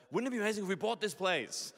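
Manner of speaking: high, loud, excited gush about buying the place; excited blurt. Retold or imitated. Pastor retells blurting to the venue manager, excited. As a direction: retell the excited blurt that got him in trouble. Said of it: "because I got excited".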